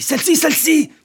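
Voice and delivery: high-pitched excited shout, building; yelling. Acted. Older man pointing out the door, yelling. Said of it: "Mais pourquoi hurlez-vous?".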